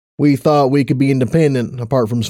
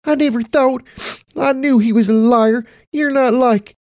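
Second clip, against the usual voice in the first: put-on high, trailing child's voice; a small girl, voice drifting off; little girl voice; 12 semitones higher than usual. Child speaks to her mother.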